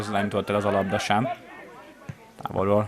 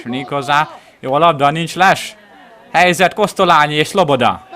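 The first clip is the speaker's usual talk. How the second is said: higher and louder rising call; excited commentary; 6 semitones higher, 7 dB louder than usual. Real, not acted. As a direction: build up to the chance. Counterattack chance. (izgatott helyzetkiáltás)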